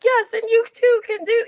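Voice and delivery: excited reply. Retold or imitated. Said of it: "And I'm like".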